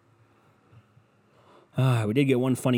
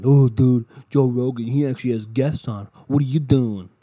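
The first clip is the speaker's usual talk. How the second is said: much louder, mocking dudebro voice; mock bro; 2 semitones higher, 8 dB louder than usual. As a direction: lampoon a pushy fan yelling at you. Mocking a listener praising Rogan.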